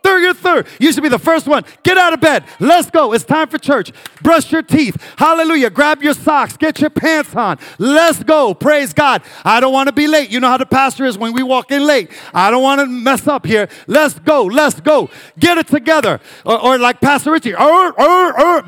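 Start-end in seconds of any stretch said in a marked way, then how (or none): 0.0-16.2 rousing shout. Acted. Parent rousing house for church. 17.5-18.6 loud, high walrus-like grunting; walrus grunts. Retold or imitated. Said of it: "I sound like a walrus".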